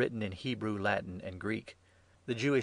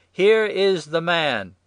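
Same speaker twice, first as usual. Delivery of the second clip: very loud, slow, high announcement; public proclamation; 8 semitones higher, 12 dB louder, much slower than usual. A narrator's reading. Pilate presenting Jesus to the crowd, proclaiming loudly. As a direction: present him in a drawn-out shout.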